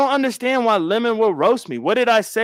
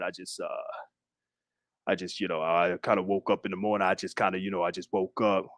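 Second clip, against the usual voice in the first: very low, quiet put-on deep voice; 12 dB quieter, faster than usual. Showing how others sound waking up, very low, quiet put-on deep voice. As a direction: drop it way down, like just woke up. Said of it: "my voice is high".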